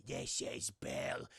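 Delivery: low, slow, unvoiced rasp "This is Bill"; a creepy old ghost introducing himself.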